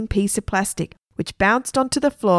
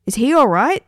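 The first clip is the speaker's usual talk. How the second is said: higher, mildly worried question; 5 semitones higher, 4 dB louder than usual. A narrator's reading. Onlookers asking about the guard, mildly worried. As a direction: ask with a touch of alarm.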